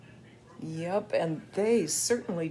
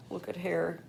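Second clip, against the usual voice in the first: usual speaking voice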